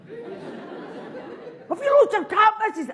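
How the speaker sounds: put-on high, slow cheeky wee boy. Cheeky wee boy asking the woman about her cat, high slow voice.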